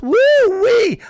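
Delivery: very high, loud hammed-up Southern whoop; mock Southern whoop. Mocking a politician pandering to Southerners, shrieked Southern whoop. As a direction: squeal it like a fake Southern belle. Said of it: "She's like".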